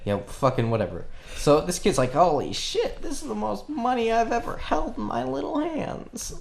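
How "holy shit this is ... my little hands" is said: put-on high breathy kid voice, much higher; high awestruck child voice; awed kid. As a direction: squeak it out like a wide-eyed kid.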